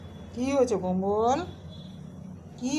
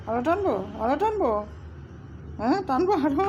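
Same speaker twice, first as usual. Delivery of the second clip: playful teasing; 5 semitones higher, faster than usual. Real, not acted. Teasing the pet, voice rising.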